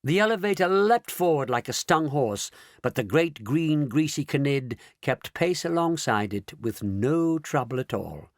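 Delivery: ordinary delivery, nothing marked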